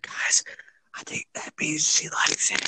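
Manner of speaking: unvoiced whisper to the camera. Real, not acted. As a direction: hush it like a secret aside. Aside whispered to viewers.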